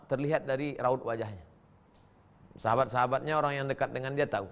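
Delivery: normal talking voice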